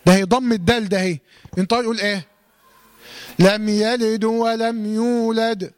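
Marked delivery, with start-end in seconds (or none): 3.4-5.7 slow chanted verse, dal in "يلد" given an u vowel; wrong recitation. Shown as a demonstration. Teacher showing students a common mistake, chanting "يلد" as "yalidu". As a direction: recite "يلد" with a wrong final damma, running "du" into "ولم". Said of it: "هذا خطأ".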